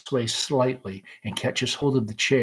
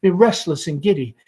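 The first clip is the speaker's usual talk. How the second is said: raised, lilting Southern-belle reminiscence; lost in a giddy memory of spring; Amanda's girlhood memories; 6 semitones higher, 5 dB louder than usual. Acted. Mother recalls her courting days, dreamy and lilting.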